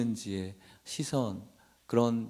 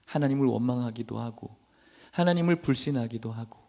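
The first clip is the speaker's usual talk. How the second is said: usual speaking voice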